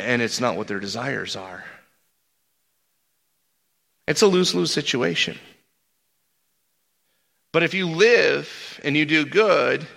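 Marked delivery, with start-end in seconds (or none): none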